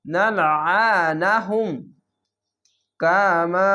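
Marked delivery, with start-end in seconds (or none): 0.1-1.7 নালাআনাহুম chanted slowly in a recitation voice; Quranic recitation. Shown as a demonstration. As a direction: recite নালাআনাহুম with long held vowels. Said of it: "হা মিম পেশ হুম".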